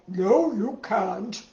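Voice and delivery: slow, lightly voiced grandpa refusal; storybook grandpa. A narrator's reading. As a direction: play a grandpa saying no, slowly. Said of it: "but Grandpa said".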